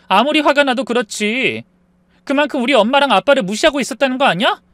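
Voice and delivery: high, loud accusing retort; angry wife. Acted. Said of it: "무섭게 화를 내더군요".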